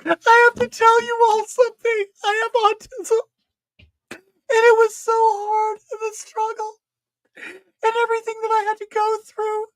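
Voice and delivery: high, far, whiny mock queen voice; a drama queen's teary reveal, hammed up; mocking drag queen confession. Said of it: "in the mirror in the workroom going".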